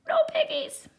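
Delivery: very high quacky duck. Acted. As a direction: squawk it like a duck. Duck answers witch.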